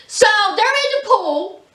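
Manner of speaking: usual speaking voice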